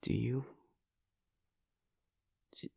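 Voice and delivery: ordinary delivery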